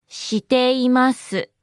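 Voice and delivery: every syllable separate and lengthened. Shown as a demonstration. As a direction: draw it out for learners. Slow repeat for learners.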